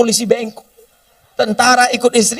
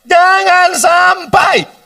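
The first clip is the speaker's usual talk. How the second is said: drawn-out warning shout; 8 semitones higher, much slower than usual. Real, not acted. Preacher warning congregation.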